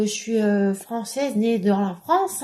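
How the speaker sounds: ordinary talk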